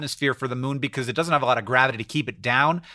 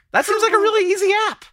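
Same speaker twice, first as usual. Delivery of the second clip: squeaky high; faster than usual. Real, not acted.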